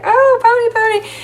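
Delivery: very high, squeaky cooing; adoring little kid. Retold or imitated. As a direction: coo over the pony like a thrilled child. Child petting the pony, delighted.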